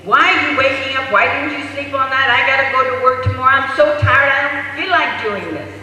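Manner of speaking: loud, high, rapid exasperated rant; exhausted mother yelling, acted; yelling at baby. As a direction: fire off angry complaints at full voice. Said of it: "yells at the baby".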